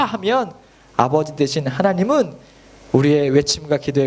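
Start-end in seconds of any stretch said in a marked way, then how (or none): none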